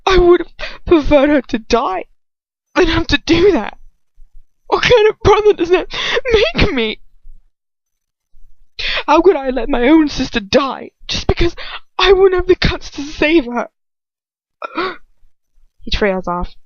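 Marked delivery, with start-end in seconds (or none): 0.0-13.7 very high, loud, wavering sob; sobbing, breaking, much higher and louder; voice cracking and wavering. Acted. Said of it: "His voice cracks and wavers all over the place". 14.6-15.0 tearful gasp. Acted. Crying outburst ends.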